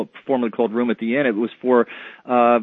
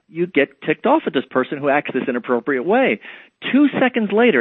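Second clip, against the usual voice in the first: ordinary talk, nothing marked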